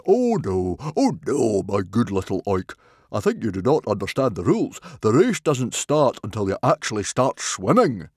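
loud, patronising chieftain; amused condescension. A narrator's reading. Stoic correcting the chief judge, patronising and loud. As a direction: put a silly official right, loudly and indulgently. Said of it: "Stoic laughed and patted".

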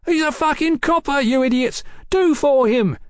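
high, frantic rant; shouting. A narrator's reading. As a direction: ruffian goading his gang at a high pitch. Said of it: "shouted the muffled man".

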